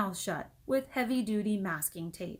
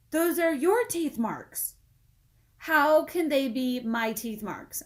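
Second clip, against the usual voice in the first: higher, slow, louder retorts; childish argument; 6 semitones higher, 8 dB louder, slower than usual. Acted. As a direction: bicker slowly. Kids arguing.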